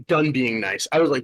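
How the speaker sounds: usual speaking voice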